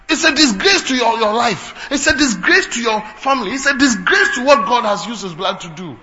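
ordinary delivery

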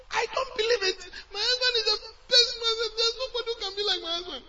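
high, loud, tearful wail of denial; widow's crying protest. Acted. Preacher voicing the widow who refuses a new husband, crying out. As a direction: cry out the widow's refusal, voice breaking.

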